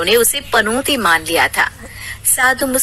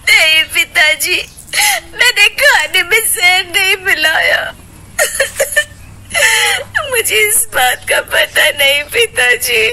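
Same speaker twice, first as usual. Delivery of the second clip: tearful plea; 7 semitones higher than usual. Acted. Ritu tearfully pleads her innocence to the sage. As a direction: beg him tearfully to believe her.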